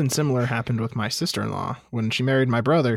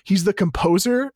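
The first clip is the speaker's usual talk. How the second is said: incredulous; 7 semitones higher, 4 dB louder than usual. Retold or imitated. Friends incredulous.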